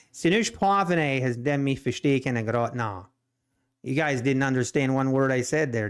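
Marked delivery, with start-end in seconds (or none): 0.1-3.1 fluent native Pennsylvania Dutch. Shown as a demonstration. To listeners who won't understand a word, Pennsylvania Dutch. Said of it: "That was Pennsylvania Dutch".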